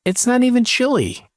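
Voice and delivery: high, fast indignant protest. Real, not acted. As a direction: protest sharply, pitch jumping up. Defending Cincinnati chili indignantly.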